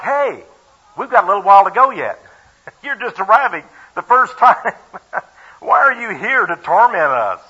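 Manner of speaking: lightly voiced complaining devils, high and loud; a hint of a whiny demon, laughing; mock devil voice. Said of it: "You know, like".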